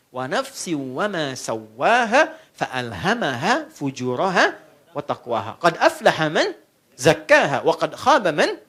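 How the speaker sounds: slow chanted Arabic verse; Quran recitation. Shown as a demonstration. Preacher chanting a Quran verse to the congregation.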